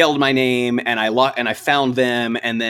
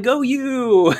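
retold cheer; 12 semitones higher than usual. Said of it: "I'm like".

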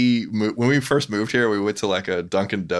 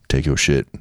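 lower, louder blunt line; rude prison server; 5 semitones lower, 5 dB louder than usual. Retold or imitated. Describing Birmingham drive-through, gruff server voice. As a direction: shove it at them like a cafeteria worker. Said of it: "It'd be like".

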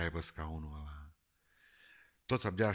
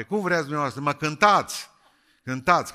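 raised, loud preacher's call to sing; loud urging; 7 semitones higher, 12 dB louder than usual. Real, not acted. Preacher loudly urging the congregation to sing, raised voice. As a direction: shout them into singing.

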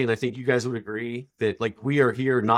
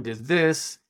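ordinary talk

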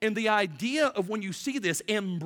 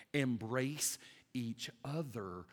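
gentle dying plea; 5 semitones lower, 7 dB quieter, much slower than usual. Acted. Jesus speaking from the cross.

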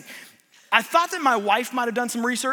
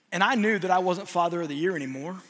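ordinary talk

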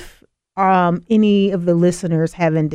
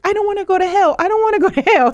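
put-on scared child; 13 semitones higher, much faster than usual. Child pleading during her baptism.

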